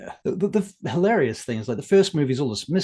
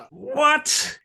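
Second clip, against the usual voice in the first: baffled; 11 semitones higher, 3 dB louder than usual. Real, not acted. Baffled by the sequel plot.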